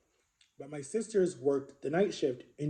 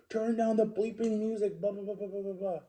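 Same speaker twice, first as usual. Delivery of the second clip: higher-pitched mock griping, tailing into babble; mimicked nagging complaint; 6 semitones higher than usual. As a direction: imitate the brother's tirade dismissively. Brother's repeated complaint, mimicked.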